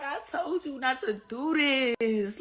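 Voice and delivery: a scared ally's soft reproach; timid warning. Retold or imitated. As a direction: say it slowly and meekly from the sidelines. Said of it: "France was like".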